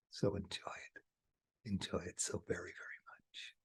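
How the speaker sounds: whisper. Acted. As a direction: hush it like a secret blessing. Soft blessing to viewers.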